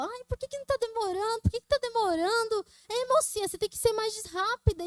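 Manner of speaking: high-pitched impatient whining, imitating customers; imitation of fed-up patients in a queue; complaining patients. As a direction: nag the receptionist in a high mocking voice. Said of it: "os pacientes reclamando".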